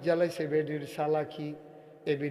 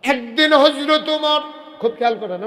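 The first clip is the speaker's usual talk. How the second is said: full-force preaching; 10 semitones higher, 12 dB louder than usual. Acted. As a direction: proclaim the story's opening loudly. Opens story at full force.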